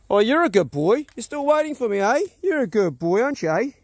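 dog praise talk. Real, not acted.

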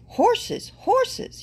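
high-pitched cry of alarm. A narrator's reading. Little burro spots horses coming, high alarmed cry. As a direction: cry out the warning, voice leaping up. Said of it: "he cried".

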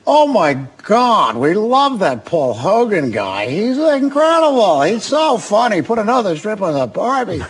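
high, drawn-out mock gushing; hammed-up starstruck squeal; gushing casting people. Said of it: "And they go".

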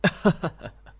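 short high laugh of pleasure; laugh, glad to see him; bright welcoming laugh. Acted. General to the doctor, a pleased high laugh.

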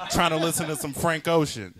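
bossy gripe at full voice; mock parental scolding. Grown son paying dad back over the clutter, loud and high.

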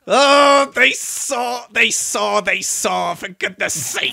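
high, loud angry shout; frustrated outburst. Real, not acted. Trap failed, he shouts out his anger. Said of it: "I'm so angry".

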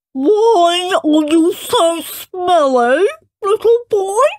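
high, slow, snooty queen voice; mock Queen voice. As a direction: play a haughty queen, nose held. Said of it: "said the Queen, holding her nose".

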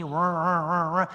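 repeated rolling car-starter noise; mock engine crank; imitated engine cranking. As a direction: mimic an engine struggling to turn over. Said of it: "It never did any".

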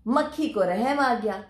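usual speaking voice

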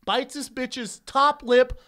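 slow build into a high, loud cry; shocked climax. Real, not acted. Comic tells friend about the bitten lip, drawn-out yelled punchline. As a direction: stretch each word, then yell the payoff.

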